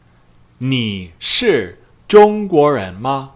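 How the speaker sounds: very slow Mandarin, "你是中国人吗" syllable by syllable; slow model question. Shown as a demonstration. 老师慢慢地示范问句. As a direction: draw out "你是中国人吗" for learners. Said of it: "Number three".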